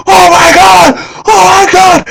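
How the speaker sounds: reenacted high-pitched fan scream. Fan reliving his favorite wrestler's return, high screaming. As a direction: shriek it in disbelief. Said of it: "I'm screaming".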